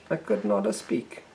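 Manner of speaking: high, slow put-on Italian accent. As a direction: drag out NOT like the Italian spy. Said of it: "they speak with the hands".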